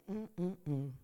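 ordinary talk

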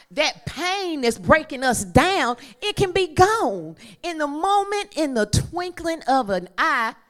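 rising high and loud preaching; sermon build toward music; preaching at full force. Real, not acted. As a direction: build to a whooping climax.

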